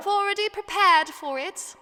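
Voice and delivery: hammed-up British accent. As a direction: slip into a cheeky UK accent. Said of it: "with this accent".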